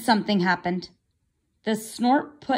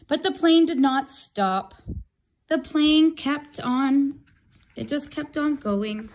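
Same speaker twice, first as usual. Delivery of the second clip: usual speaking voice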